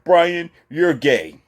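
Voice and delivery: high, loud mocking woman's voice. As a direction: sneer at Brian in a high put-on voice. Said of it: "And she say".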